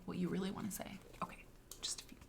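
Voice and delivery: soft whispered aside. Real, not acted. Musing to students, whispered. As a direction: drop to a whisper.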